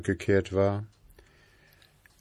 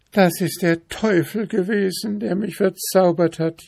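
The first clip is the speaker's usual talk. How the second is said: high lamenting princess voice; wailing princess; 9 semitones higher than usual. A narrator's reading. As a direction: lament the devil's spell. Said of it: "jammerte die Prinzessin". (hohe, jammernde Prinzessinnenstimme)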